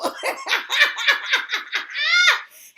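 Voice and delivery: high, loud burst of laughter; loud, high helpless laughing. Real, not acted. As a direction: crack up loudly.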